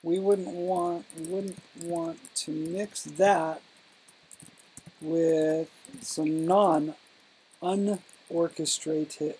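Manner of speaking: very drawn-out, word by word; halting, with long gaps and repeats; slow dictation. Real, not acted. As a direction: drag the sentence out while writing it. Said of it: "you're speaking too slow".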